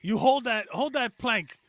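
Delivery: high, loud barking drill-sergeant orders; drill coach. Pretend. Dominatrix fitness coach barking at a client, harsh shouted commands. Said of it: "hire a dominatrix for, to, like, be my, uh, boot camp coach".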